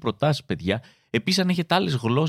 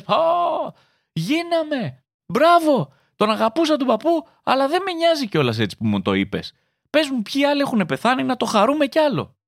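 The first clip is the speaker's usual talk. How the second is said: joyful cheer; 12 semitones higher than usual. Pretend. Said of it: "Λες".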